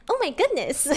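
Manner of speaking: put-on high, sweet innocent girl-next-door. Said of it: "I think [name] is like".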